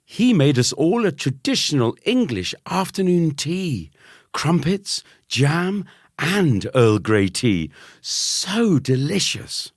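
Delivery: very high, gushing mother; gushing mum. A narrator's reading. Mum gushing about the cousin's English tea, very high. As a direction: swoon over the tea.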